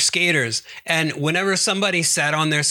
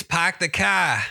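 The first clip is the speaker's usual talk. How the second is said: slow, stretched Boston accent with R-less "car"; 3 semitones higher, slower than usual. Shown as a demonstration. Podcaster attempts Boston stock phrase, stretched Boston accent. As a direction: drop the R in a drawn-out Boston accent. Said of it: "can't even do the Boston accent".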